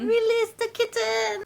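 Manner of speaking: very high, put-on squeal of a proclamation; mock dramatic command. Host echoing co-host while freeing the cat, mock dramatic squeal. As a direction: echo it way up high, playfully.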